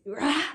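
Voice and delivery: short loud roar of rage; imitating his furious yell; imitated scream. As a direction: burst out a raw "Rah". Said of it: "he's screaming".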